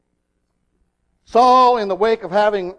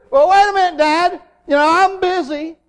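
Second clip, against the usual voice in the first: put-on higher, protesting child putting Dad off; arguing; 7 semitones higher, 4 dB louder than usual. Little boy arguing back to his father, mock protest. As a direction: stall your father with an indignant excuse. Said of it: "what if Philip had argued with his father".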